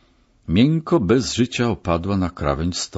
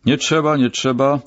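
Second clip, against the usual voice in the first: mild, higher-pitched call; 7 semitones higher, 3 dB louder than usual. A narrator's reading. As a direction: call out to stop them, without full shouting. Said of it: "zawołał, podbiegając". (zawołanie podniesionym głosem)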